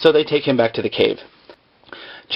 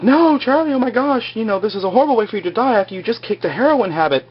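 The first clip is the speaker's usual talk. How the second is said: much higher and fast, hammed-up panic; mock freaking out; 10 semitones higher, faster than usual. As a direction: squeal out the horror at top speed. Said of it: "Jack's all freaking out".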